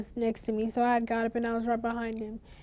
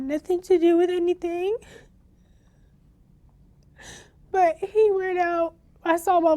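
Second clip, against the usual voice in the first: crying; 6 semitones higher, 5 dB louder than usual. Real, not acted. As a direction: voice breaking into tears, higher and louder. Survivor breaking down over Jaden.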